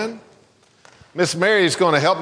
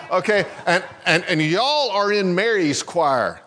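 normal talking voice

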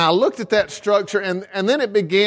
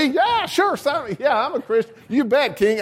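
mock-eager yes-man, much higher; eager subject; 7 semitones higher than usual. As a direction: squeak out a hasty agreement to the king. Subject eagerly agreeing with king.

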